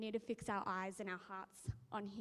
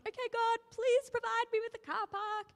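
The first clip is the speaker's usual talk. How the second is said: high, louder chirpy plea; hopeful prayer; 12 semitones higher, 8 dB louder than usual. Retold or imitated. Driver asking God in Grand Central, high chirpy plea. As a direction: ask God brightly for a park. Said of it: "I was like".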